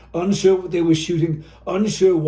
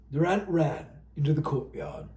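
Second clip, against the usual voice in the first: ordinary delivery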